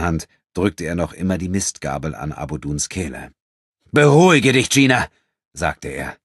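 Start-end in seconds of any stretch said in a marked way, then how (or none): 3.9-5.1 firm calming order. Acted. Enrico tells Gina to calm down.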